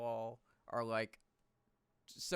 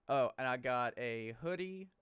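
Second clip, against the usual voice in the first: ordinary talk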